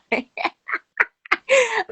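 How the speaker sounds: a burst of high laughter. Real, not acted. Host laughs at comment.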